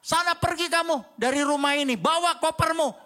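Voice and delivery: mother scolding her son, imitated angrily; angry mother. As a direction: play the furious mum throwing her kid out. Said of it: "Ada ibu yang ngomong gini".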